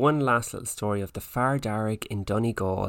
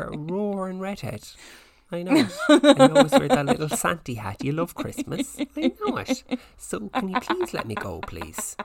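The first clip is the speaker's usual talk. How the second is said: high pleading voice; 10 semitones higher, 5 dB louder than usual. Pretend. Pleading to be let go.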